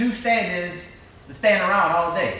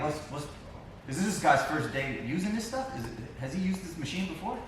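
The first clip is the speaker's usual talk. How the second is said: producer's doubtful question, much softer; retold quiet questioning; 8 dB quieter than usual. Producer watching takes asks about the Steadicam guy, quietly doubtful.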